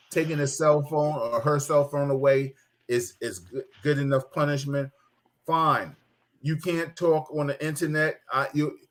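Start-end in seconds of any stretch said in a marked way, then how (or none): none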